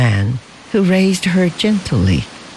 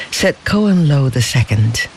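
usual speaking voice

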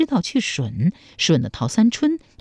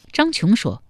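ordinary delivery

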